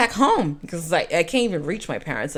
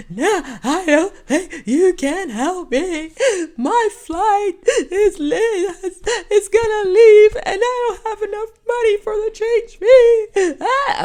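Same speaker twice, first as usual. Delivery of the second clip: loud, high, drawn-out wailing; hammed-up bawling; 14 semitones higher, 8 dB louder, slower than usual. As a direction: sob out each phrase as a mock meltdown. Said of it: "I was just crying".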